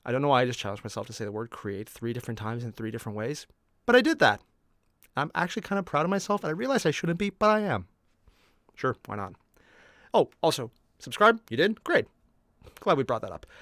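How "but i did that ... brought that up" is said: usual speaking voice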